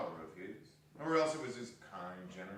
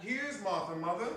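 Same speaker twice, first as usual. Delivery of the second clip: put-on higher, bright girl's voice; young girl; 9 semitones higher, 4 dB louder than usual. A girl tells her mother Martha is home, higher and eager. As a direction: announce it like an eager daughter. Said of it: "said a girl".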